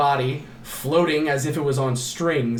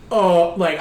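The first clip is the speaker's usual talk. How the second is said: ordinary delivery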